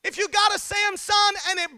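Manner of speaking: high-pitched, insistent sermon delivery; climbing, urgent pulpit cry; impassioned preaching. Real, not acted. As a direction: drive each point home with a lifted voice. Preaching rising to full force.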